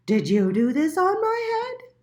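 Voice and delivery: high, loud squeaky question; a small indignant mole demanding answers; little mole voice. A narrator's reading. Little Mole asking the pigeon, high and indignant.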